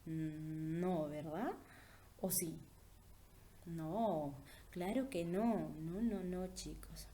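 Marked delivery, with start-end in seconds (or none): none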